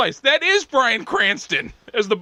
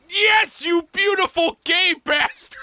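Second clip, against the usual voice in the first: mock triumphant; 7 semitones higher, slower than usual. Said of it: "looking at the report going".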